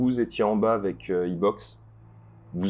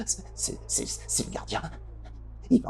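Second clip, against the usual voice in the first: frightened whisper; 8 semitones higher, 7 dB quieter than usual. Acted. Panicked NPC whispering warning.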